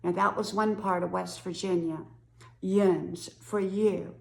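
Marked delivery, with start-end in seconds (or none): 2.6-3.3 "yins" drawn out slowly; dialect "yins". Shown as a demonstration. Explaining a holler's word for you, dialect "yins". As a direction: say "yins" slow, as one long syllable. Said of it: "one holler would say yins".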